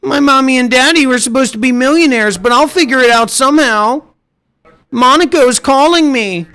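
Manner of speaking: high mock voice of a deluded rich-kid hopeful; mock a spoiled dreamer, drawing out the words; millionaire wannabe. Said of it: "mommy, daddy, millionaire vibes".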